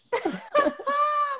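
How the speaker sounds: high, bright burst of laughter; quick high laugh. Real, not acted. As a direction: laugh at your own joke. Laughing at Patiflix joke.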